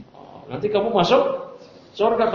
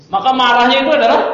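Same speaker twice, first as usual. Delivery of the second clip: ordinary delivery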